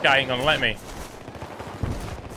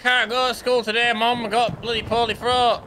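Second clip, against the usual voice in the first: put-on high, whiny sick-kid voice; poorly child; 9 semitones higher than usual. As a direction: play the ill child pleading with mum. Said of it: "you're a great actor".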